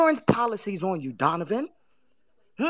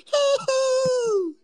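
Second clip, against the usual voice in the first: high squealing laugh; 13 semitones higher than usual. Real, not acted. Laughing after shout out.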